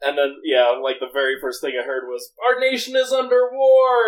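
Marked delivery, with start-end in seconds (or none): 2.4-4.1 high, loud mock rant; imitating a yell. Retelling what the man first yelled, as a mock yell. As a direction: shout it like the man on the corner. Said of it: "he was yelling and screaming".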